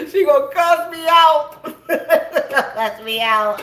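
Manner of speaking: high, loud laughing shout; laughing hard. Real, not acted. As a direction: blurt it while cracking up.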